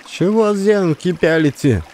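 put-on high, sharp village woman scolding the boys; scolding shout. A narrator's reading. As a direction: snap at the gawking boys. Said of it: "Напустилась она на пацанов". (баба накидывается на пацанов, резкий окрик)

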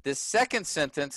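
usual speaking voice

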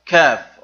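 كاف said loud and crisp; clear letter name. Shown as a demonstration. Teacher models the letter كاف loudly for students. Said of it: "Who can pronounce this letter?".

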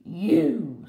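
loud, pouncing wolf reply; hungry storybook wolf; menacing wolf. Acted. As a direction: drop the disguise and threaten. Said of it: "said the wolf".

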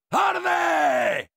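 high, drawn-out yell; battle yell. Acted. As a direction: scream it at the enemy as they burn. Yells burn at enemy with flamethrower.

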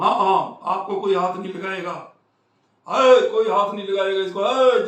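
hammed-up crowd men promising nobody will touch him; loud imitated crowd assurance; imitating reassuring mob. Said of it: "ਜਿਵੇਂ ਕਰਦੇ ਹੁੰਦੇ ਆ ਉਹ".

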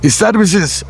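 ordinary talk, nothing marked

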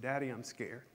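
put-on soft, understated voice of a scared little boy; tearful child, retold. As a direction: quietly echo a child speaking through tears. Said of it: "spoke through his tears".

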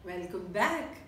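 slow, friendly teacher opening story time; storytelling teacher. Acted. Teacher opening story time for her class, friendly. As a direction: welcome the class like a children's storyteller.

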